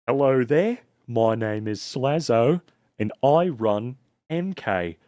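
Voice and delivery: over-the-top Australian accent; slow, exaggerated Aussie introduction. Shown as a demonstration. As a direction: lay the Australian accent on thick. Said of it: "I'm trying to go over the top".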